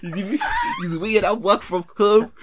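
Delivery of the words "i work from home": laughing mimicry. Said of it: "He's saying them".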